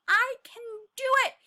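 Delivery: high, loud cheer; excited shout. Acted. Tiny cheering himself on, high and loud. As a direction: shout it out with confidence. Tiny's confident cry.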